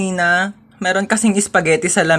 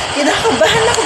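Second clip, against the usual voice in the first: nervous laugh; 10 semitones higher, 3 dB louder than usual. Acted.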